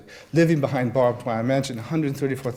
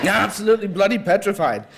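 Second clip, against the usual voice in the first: higher, louder, every word hammered; emphatic repeat; 7 semitones higher, 7 dB louder than usual. A narrator's reading. Nick repeating his fear to the nervous author, emphatic. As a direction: lean hard on bloody petrified. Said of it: "he repeated for emphasis".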